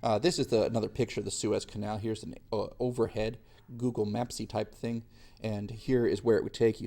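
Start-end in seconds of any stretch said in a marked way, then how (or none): none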